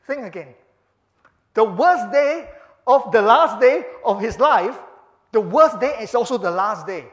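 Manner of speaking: loud, rising preacher's exhortation, slowing on key words; full-force preaching. Acted. Preacher urging the congregation about the cross, loud and fervent.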